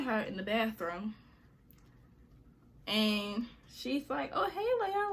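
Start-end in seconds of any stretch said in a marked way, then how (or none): 4.3-5.1 much higher sugary greeting; imitating a fake-friendly hello; fake nice. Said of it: "Being real, real nice".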